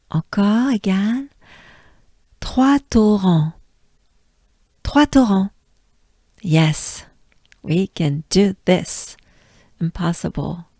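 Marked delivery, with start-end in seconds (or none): 2.4-5.5 Trois torrents crisp, breathy R, nasal end; French Trois torrents. Shown as a demonstration. Teacher leads the class in Trois torrents. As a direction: say Trois torrents all together. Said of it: "Let's go together".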